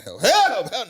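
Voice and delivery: loud, high mock cry for help; husband yelling for help back at his wife. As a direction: holler it like you're under attack. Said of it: "so I start yelling".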